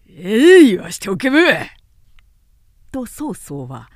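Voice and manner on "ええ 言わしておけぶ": a sudden much louder, higher roar of rage; enraged minister roaring at the defiant prisoner; furious outburst. Acted. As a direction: explode like an enraged lion. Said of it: "獅子のごとく憤怒して".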